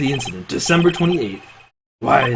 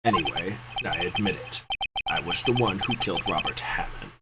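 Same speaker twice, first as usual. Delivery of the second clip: quiet confession; 5 semitones lower, 7 dB quieter than usual. Acted.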